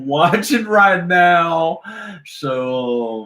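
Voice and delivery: usual speaking voice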